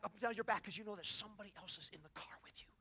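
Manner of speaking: hushed and unvoiced; whispered. Real, not acted. Preacher describing the shivers of God's presence, whispering. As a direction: let it fall to a whisper. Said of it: "whispers feeling of presence".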